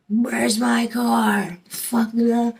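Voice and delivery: high, loud, drawn-out angry yell; mimicked cussing out. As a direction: imitate an irate man cussing down the phone. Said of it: "he's cussing me out".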